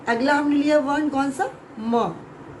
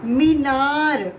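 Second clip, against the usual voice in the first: slow sounding out; 3 dB louder, much slower than usual. Shown as a demonstration. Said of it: "teacher sounding out a word".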